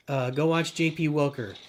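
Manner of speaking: ordinary delivery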